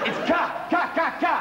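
"ka, ka" spat out high as repeated bursts; mock the hard "ka" starting each word; exaggerated harsh consonant. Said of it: "gardening implements at the beginning of each word".

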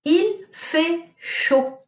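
teacher quizzing class with Il fait chaud; slow model sentence. Shown as a demonstration. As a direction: stretch Il fait chaud out word by word. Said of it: "what does it mean if I say".

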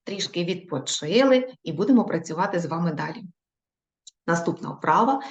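ordinary talk, nothing marked

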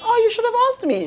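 mock know-it-all. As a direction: play the friend who knew all along. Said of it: "you're like".

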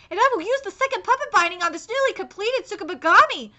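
normal talking voice

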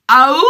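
long, loud, high wolf howl. Shown as a demonstration. Puppet shows how its wolf ancestors howl, loud howl. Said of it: "when wolves howl".

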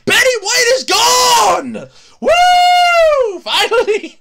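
high, drawn-out whooping shout; gleeful shout. Real, not acted. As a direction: holler it out like a long-awaited victory. Gleeful announcement he warned about.

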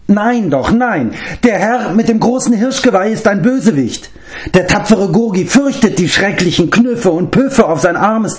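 a pitiful little creature complaining; whining beast-man. Acted. Gurgi complains he gets no thanks, high whining creature voice.

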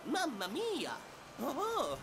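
put-on Italian-accented German, Mario-like; Italian plumber accent, surprised. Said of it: "mit italienischem Akzent".

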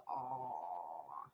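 low, drawn-out sympathetic aw; mock pitying aw. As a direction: imitate viewers cooing in pity. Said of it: "not for you to go".

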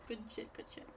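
breathy hushed repeat; whispering. Real, not acted. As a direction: whisper it to yourself.

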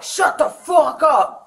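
gruff angry shout, slowed; retold angry yell. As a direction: bark it like an angry neighbor. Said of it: "And he was like".

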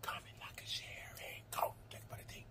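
breathy ASMR whisper. Shown as a demonstration. Whispering the sign-off to her viewers, ASMR style. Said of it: "as long as I say".

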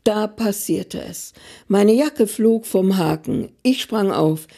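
ordinary delivery, nothing marked

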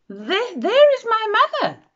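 excited. Acted. Baby bird spots the big thing.

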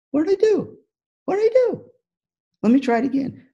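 very high squeaky whale voice; Shamu's voice. Acted. Speaker voices Shamu for teachers, high and puzzled.

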